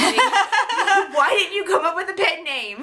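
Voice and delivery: high, slow, laughing exasperation; mock despair at a silly name; exasperated. Mock exasperation at author names.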